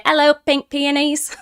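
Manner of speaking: playful, unconvincing British imitation; bad British accent. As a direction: have a clumsy go at a British greeting. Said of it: "I can't do your British accent".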